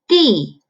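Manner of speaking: "dì" with a firm falling tone; fourth tone. Shown as a demonstration. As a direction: drop the tone of D from top to bottom. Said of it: "the fourth tone".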